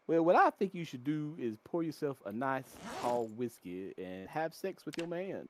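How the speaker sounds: smooth, slow seductive radio host; ladies man radio DJ. Retold or imitated. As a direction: croon it like a late-night love expert. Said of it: "he would say".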